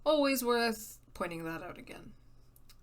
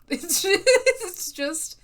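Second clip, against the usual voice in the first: laughing; 10 semitones higher, 10 dB louder, much slower than usual. Real, not acted. Laughing at own bad accent.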